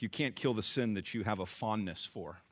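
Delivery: normal talking voice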